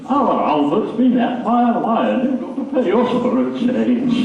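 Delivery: high, comic northern-monologue mother; mother's voice. Acted. Mother telling the insurance man the news, high voice. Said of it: "said Mother".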